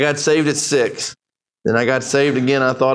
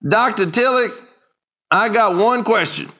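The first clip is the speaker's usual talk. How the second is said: put-on old preacher voice; 6 semitones higher than usual. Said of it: "an old dark-skinned preacher".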